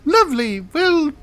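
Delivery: high falsetto, halting; awkward daughter hostess. Acted. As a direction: announce dinner hesitantly in a high voice. Game master answers as the daughter.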